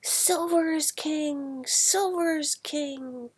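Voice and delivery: high, loud, slow chant; rallying cry. Acted. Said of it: "the cry went up".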